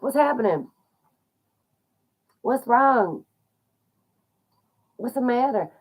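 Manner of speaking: confused bystanders. Retold or imitated. Voicing confused bystanders on the tracks.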